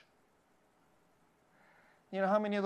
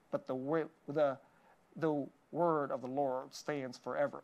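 imitating Southern preacher; 4 semitones lower, 4 dB quieter than usual. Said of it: "so he sounded like this".

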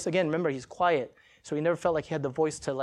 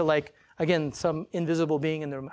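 ordinary delivery

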